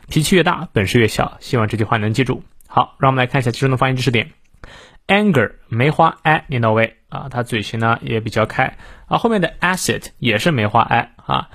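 usual speaking voice

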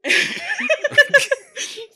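loud high-pitched laugh; high burst of laughter. Real, not acted. Laughing at a friend's mouth-breather jab, loud and high. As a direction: crack up at the joke.

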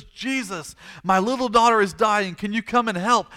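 high, urgent begging; desperate plea. Acted. Desperate father begging Jesus to help his dying daughter, urgent pleading. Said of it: "He's desperate".

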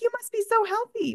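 very high, gushing fake admiration; mock the shallow compliment; squealing mock compliment. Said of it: "we look at a skinny person and we say".

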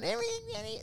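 high, thin mock call of a girl's name; high-pitched mock wail; mock girly calling. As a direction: call out like a panicked girl. Mimicking girls calling out.

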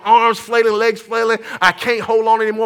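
ordinary talk

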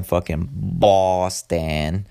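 slow, hammed-up Southie Boston accent; a self-mocking, overdone Boston accent; bad Boston accent. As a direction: lay on a clumsy Boston accent, dragging the words. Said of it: "Sorry, fucking horrible accent".

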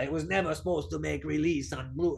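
a hint of a fussy Italian director; mock Italian director. Joking about a dead director, mock Italian accent. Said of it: "director's like".

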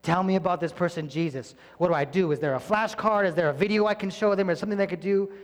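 eager questions. Retold or imitated. New believer asking the pastor, eager. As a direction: pepper him with eager questions. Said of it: "They come and tell me".

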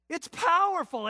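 high, loud punch; emphatic preaching. Real, not acted. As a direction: declare it with force.

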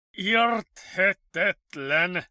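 loud, high, slow drawn-out word; puzzled director. A narrator's reading. Director repeating himself over the case, puzzled. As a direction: stretch it out in bafflement. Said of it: "ismételte doktor Kaiser".

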